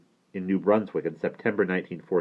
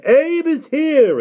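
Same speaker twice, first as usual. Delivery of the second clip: high, drawn-out mock holler; imitated yell; 11 dB louder, much slower than usual. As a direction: call it out loudly over a crowd. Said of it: "but he's yelling".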